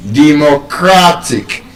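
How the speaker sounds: "Democratic" slow, CRA held; penultimate stress. Shown as a demonstration. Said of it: "second to the last syllable".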